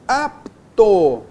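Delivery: "apto" broken as "ap... to", both beats drawn out; teacher separating "ap" from "to" in "apto"; syllables split apart. Shown as a demonstration. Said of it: "ficam em sílabas diferentes".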